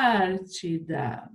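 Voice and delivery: "Antártida" slowly drawn out, weight on TÁR; stress on TÁR. Shown as a demonstration. Teacher letting pupils think, stressed on TÁR. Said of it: "Qual que é a sílaba tônica?".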